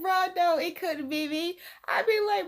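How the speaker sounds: high-pitched, incredulous reaction; high amused disbelief. Real, not acted. Picturing it happening to them, high amused disbelief.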